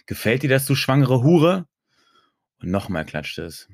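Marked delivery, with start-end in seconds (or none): 0.1-1.7 domineering taunt. A narrator's reading. Acted domineering taunt in story.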